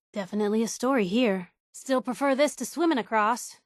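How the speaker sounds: normal talking voice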